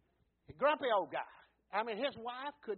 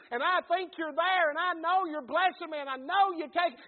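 raised, louder, fervent sermon delivery; preaching at full force; 8 semitones higher, 10 dB louder than usual. Acted. Pastor urging the church to show faith in worship, full-force preaching. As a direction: lift the room with each phrase.

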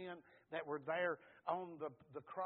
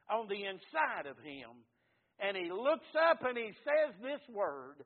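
building from firm to loud, raised preaching; preaching at full force; 3 semitones higher, 7 dB louder than usual. Acted. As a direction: drive it home to the congregation, louder and louder.

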